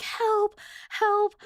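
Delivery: high-pitched mock yell for help; retold yell. Sleeper retelling a try at waking up, mock yell. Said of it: "I'm, like, yelling".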